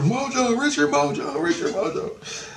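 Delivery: thin, high imitation of a crowd crying out; imitated screaming. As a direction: mimic distant screamers. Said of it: "they're all screaming".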